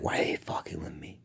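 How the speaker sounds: fast whisper. Retold or imitated. Inner complaint about staff.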